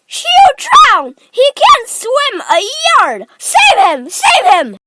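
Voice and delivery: panicked high screaming; Missus Gloop. A narrator's reading. Mother seeing her son drown, screaming in panic. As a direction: plead loudly in sheer panic. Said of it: "screamed Missus Gloop".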